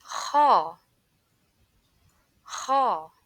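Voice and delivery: Kha with a rasping throat scrape; snoring-like Kha. Shown as a demonstration. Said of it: "the sound of snoring".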